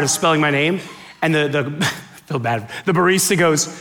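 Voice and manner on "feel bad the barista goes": ordinary delivery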